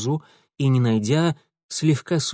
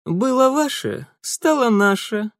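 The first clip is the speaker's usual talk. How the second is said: smirking; 9 semitones higher than usual. A narrator's reading. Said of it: "хмыкнул Франт".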